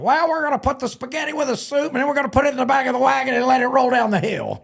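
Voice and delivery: high, fast, goofy rambling; imitating Bob. Announcer Bob rambling nonsense at the podium, high fast goofy voice. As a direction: run on fast like a silly announcer. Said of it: "Bob would be like".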